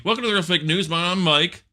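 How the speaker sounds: bright high anchorman opening; seventies newscaster. Pretend. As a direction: greet viewers like a seventies TV anchor. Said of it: "Seventies style news".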